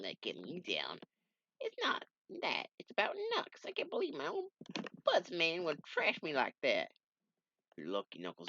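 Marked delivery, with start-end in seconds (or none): 1.6-6.9 squeaky, high upset voice; a hurt, high-pitched sidekick; high comic character voice. Acted.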